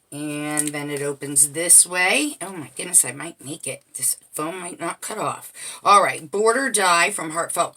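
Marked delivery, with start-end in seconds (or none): 2.4-5.5 muttering to herself. Real, not acted. Muttered to herself while working.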